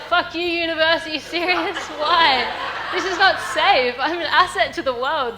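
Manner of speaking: high, loud, panicky protest; panicked outburst. Retold or imitated. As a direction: freak out at the very idea of jumping. Panicking at the bungee jump.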